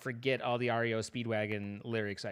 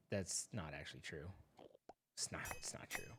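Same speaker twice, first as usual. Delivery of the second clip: real whisper, much softer; whispered aside; 9 semitones lower, 16 dB quieter than usual. Real, not acted. Gamer whispering a private correction to the viewers. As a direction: breathe it as a secret correction.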